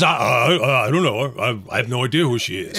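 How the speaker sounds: low, stammered mock denial; weaselly denial. Co-host imitating the cowardly lover denying he knows her, weaselly. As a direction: stumble over disowning her, lightly acted. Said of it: "what a weasel".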